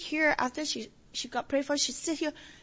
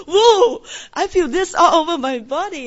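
loud, high burst of amazement; excited exclamation; 5 semitones higher, 14 dB louder than usual. Retold or imitated. Church member imitates a young man's first experience, loudly excited. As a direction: whoop it out, imitating an overwhelmed convert. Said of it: "he was so excited".

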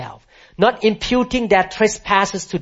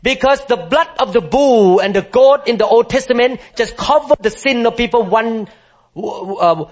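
preaching at full force; 8 semitones higher, 3 dB louder than usual. Acted. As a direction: declare it to the whole hall with rising fervour.

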